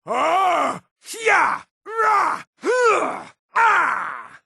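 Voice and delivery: very high shouted combat efforts; short yells thrown with each strike; attack cries. Acted.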